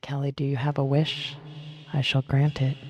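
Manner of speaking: usual speaking voice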